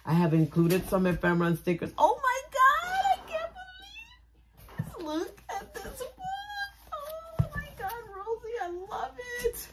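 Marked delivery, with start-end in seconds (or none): 2.0-9.7 high, cracking squeals of joy, half laughing half crying; tearful squeal. Real, not acted. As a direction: squeal through happy tears as the gift overwhelms you. Overwhelmed opening a gift.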